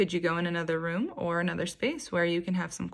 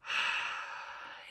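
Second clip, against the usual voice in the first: long, soft, breathy sigh out; audible meditation out-breath; calming exhale; 8 dB quieter than usual. Shown as a demonstration. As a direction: slowly let the breath out to model relaxing. Models breathing out during meditation.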